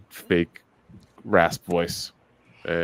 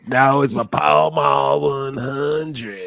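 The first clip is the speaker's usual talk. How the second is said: fake Southern Keanu voice; 5 semitones higher, slower than usual. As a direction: bellow it slow as a hammy Southern caller. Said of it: "did that thick Southern fake rasp voice".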